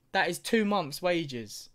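ordinary delivery

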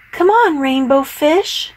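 high bright calling voice. A narrator's reading. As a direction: call out cheerfully. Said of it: "they would call".

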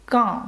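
quand said with a nasal "an", no final d; French Quand with a nasal vowel and silent d. Shown as a demonstration. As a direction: say Quand as a clear nasal kɑ̃. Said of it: "Це носовий звук".

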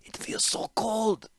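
slow, faint and high, shivering from cold; weak whisper. A narrator's reading. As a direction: fade out, shaken by the chill. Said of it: "managed the Falaji".

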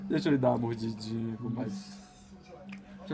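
soft, low teasing plea; low, hushed mock wheedling; playful coaxing. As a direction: coax her quietly, pretending to want a nibble. Playfully coaxing grandmother for a bite.

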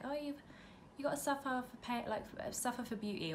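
high-pitched mimicry of an annoying saying; put-on high voice, quoting dismissively; mocking quote. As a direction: parrot a saying you can't stand, pitched well up. Quoting a saying she hates.

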